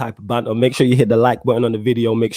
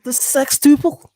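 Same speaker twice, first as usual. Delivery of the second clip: slow high Scouse "sextuple"; drawn-out mock Liverpool accent; mock Scouser; 12 semitones higher, slower than usual. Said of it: "a Scouser trying to say sextuple".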